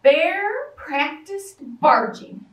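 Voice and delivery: ordinary delivery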